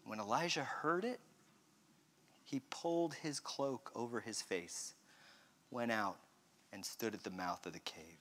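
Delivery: very soft, low, almost whispered; hushed reading. Acted. Preacher reading Elijah's response to the whisper, hushed. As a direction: keep it tiny and low, pausing between phrases.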